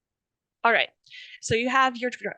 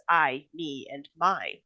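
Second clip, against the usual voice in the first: "I", "me" and "my" each set apart; stress on italicized words; 5 semitones lower, slower than usual. Shown as a demonstration. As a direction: give "I" and "my" a firm, separate punch. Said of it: "italicizes every time Sabine says".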